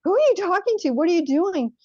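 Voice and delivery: high-pitched imitated alarm, voice jumping up; retold upset mother. Mother catching her child checking with a guide, mock upset. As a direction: act out a parent's agitated interrogation. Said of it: "she got really upset".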